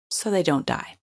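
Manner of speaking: ordinary talk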